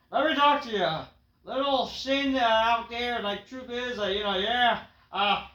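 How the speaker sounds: high, loud mock promo shout; hammed-up wrestler yelling at his rival; L A Knight impression. L A Knight calls out his rival in a loud mock shout. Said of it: "and is like".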